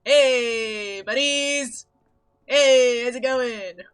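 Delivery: loud hammy hello to the chat; loud greeting call. Acted. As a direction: call out to friends across a room. Calling out greeting to viewers.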